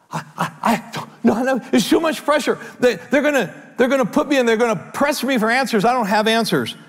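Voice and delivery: high, pleading, panicky protest; mock panic. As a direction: beg to be let off, frantic. Imagined frightened disciple pleading.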